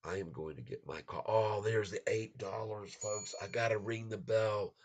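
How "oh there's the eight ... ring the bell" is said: loud, high, thrilled; cheering the price breaking eight; excited. Real, not acted. Price hits eight dollars.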